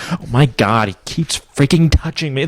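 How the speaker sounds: lightly imitated grossed-out girl; disgusted girl. Girls' disgust, retold.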